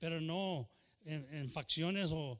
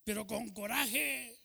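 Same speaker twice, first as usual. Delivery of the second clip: higher, slow, drawn-out urging; "Pero con coraje" stretched slow and pitched up; uncle urging; 6 semitones higher, slower than usual. Retold or imitated. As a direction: egg the kids on like the uncle pushing the car. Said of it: "decía el tío".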